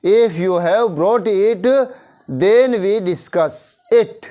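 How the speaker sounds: very slow, each word spaced out for learners; slow dictation. Shown as a demonstration. Teacher to Hindi-speaking class, slow clear English model sentence. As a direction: spell it out slowly so the class can follow. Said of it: "ध्यान से सुनो".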